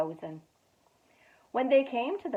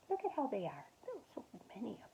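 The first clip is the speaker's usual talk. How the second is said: quiet aside; 12 dB quieter, much faster than usual. Real, not acted. Aside about picture, whispered.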